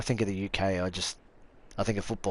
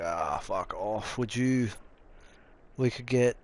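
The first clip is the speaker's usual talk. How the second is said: lightly voiced mock bogan, slow drawn-out start; a hint of a yobbo brushing someone off; bogan impression; slower than usual. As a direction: sneer it like a lazy bogan. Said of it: "every time I hear bogans, it's like".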